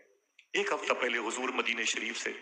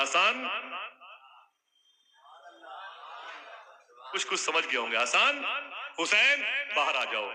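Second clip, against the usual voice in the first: calling out names; 6 semitones higher than usual. Acted. Preacher calls out the names.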